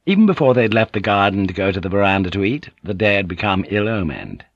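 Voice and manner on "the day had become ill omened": usual speaking voice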